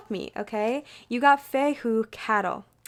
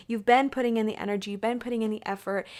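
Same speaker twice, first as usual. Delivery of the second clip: ordinary talk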